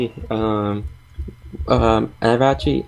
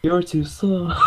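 high cartoon hedgehog taunt; Sonic taunt; 7 semitones higher, slower than usual. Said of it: "That's the buff sound".

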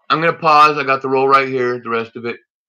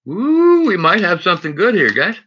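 excited; 6 semitones higher, 3 dB louder than usual. Real, not acted. Spots a promising coin.

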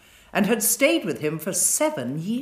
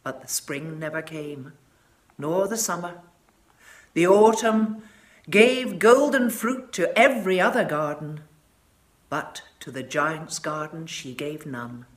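ordinary delivery

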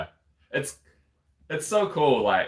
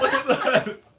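much higher, giddy and laughing; excited squeal; 4 dB louder, slower than usual. Retold or imitated. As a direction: squeal it like a starstruck fan. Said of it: "I just wanna be like".

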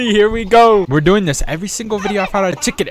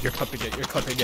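very soft, low murmur; quiet, low-voiced repetition; hushed repeat; 8 semitones lower, 15 dB quieter, faster than usual. Real, not acted. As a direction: drop right down and murmur it. Repeats it much more softly.

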